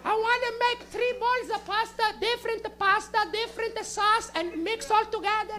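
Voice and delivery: put-on high Italian-accented grandma voice; stoned Italian nonna. Comic as nonna twenty minutes later, stoned Italian grandma. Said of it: "Nonna, you okay?".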